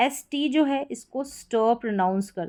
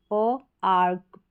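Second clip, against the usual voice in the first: slow, split into प and arc; sounded out; much slower than usual. Shown as a demonstration. Teacher sounding out park for students slowly. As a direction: break the word into sounds slowly. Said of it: "park हो गया".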